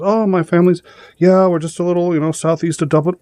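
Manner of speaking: high stage-Irish lilt, eager to please; Irish driver. Retold or imitated. A driver answering the cop, in a high stage-Irish voice. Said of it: "He says".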